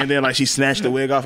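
ordinary talk

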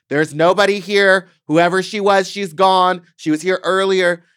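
loud, high insistent yelling through a door; retold yell.

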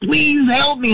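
high, pleading cry for help; high mock plea from the ditch; desperate plea. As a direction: beg like you're trapped. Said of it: "he goes".

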